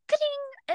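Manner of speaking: high imitated TV click-off; old TV shut-off sound. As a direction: pop it out like an old TV switching off. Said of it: "it would be like".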